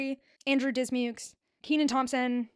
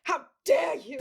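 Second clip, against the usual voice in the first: higher mock-outraged voice; funny affronted voice; 5 semitones higher, slower than usual. Retelling the slap back, hammed-up affronted voice. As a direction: play the offended gentleman, pitched up. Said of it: "in different funny voices".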